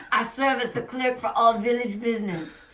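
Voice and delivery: hammed-up French accent, official introduction. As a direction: speak up as a French village clerk. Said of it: "oh, he's French".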